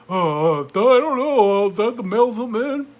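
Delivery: high, loud, slow dopey voice; mock dumb voter. Mocking a clueless voter, high and loud. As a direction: play the clueless voter, dragging it out.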